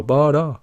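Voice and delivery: high-pitched vocal chop mimic; high two-note mouthed sample; imitated vocal chop. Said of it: "these vocal chops that go".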